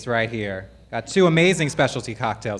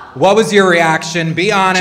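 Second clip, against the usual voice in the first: loud teasing demand; 6 semitones higher, 11 dB louder than usual. Real, not acted. Host pressing guest for honest answer.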